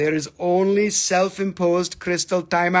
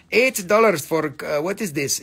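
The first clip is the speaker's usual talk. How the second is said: ordinary talk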